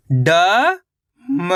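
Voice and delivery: ड-म split into separate syllables. Shown as a demonstration. Teacher sounding out a word for young pupils, syllable by syllable. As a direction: sound out ड-म piece by piece. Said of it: "मिलके बन जाएगा डमरू".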